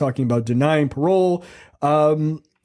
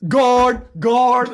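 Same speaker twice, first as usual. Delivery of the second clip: raised, high call for the guard; 9 semitones higher, 4 dB louder than usual. Acted. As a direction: summon a guard, acted out. Acting out calling the guard.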